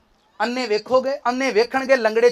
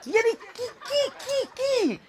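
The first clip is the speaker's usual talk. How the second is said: mock incredulous squeak; 11 semitones higher, 10 dB quieter, much slower than usual.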